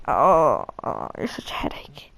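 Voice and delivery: whispered, low grumble; under my breath. Retold or imitated. Annoyed kid grumbling at the shopkeeper under breath, whisper. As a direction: mutter it so she barely hears. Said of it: "Under my breath".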